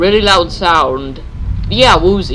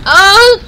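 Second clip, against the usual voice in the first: startled cry; 12 semitones higher, 3 dB louder than usual. Real, not acted. Jump scare in horror game.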